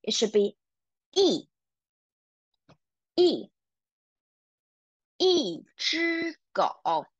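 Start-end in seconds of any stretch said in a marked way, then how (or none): 1.1-3.5 一 said as yì, sharp falling fourth tone; teacher modelling the falling tone of 一. Shown as a demonstration. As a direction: drop 一 hard from high, fourth tone. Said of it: "we have to pronounce it as the fourth tone".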